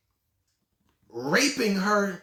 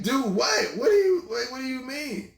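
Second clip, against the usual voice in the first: confused protest; 5 semitones higher than usual. Retold or imitated. He reacts, confused.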